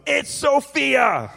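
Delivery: high, drawn-out mock scream; imitated scream. Pastor imitating screaming Theo blaming Sophia, high drawn-out yell. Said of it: "Theo was screaming".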